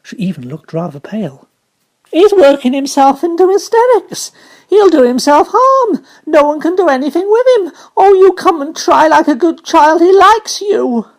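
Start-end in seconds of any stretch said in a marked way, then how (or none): 2.1-11.1 high, loud, pleading in fright; frantic servant pleading; panicked nurse. Acted. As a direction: beg the child to help, alarmed. Frightened nurse begging Mary.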